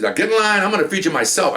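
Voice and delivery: lightly voiced lady herding them into line; bossy auntie. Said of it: "I said".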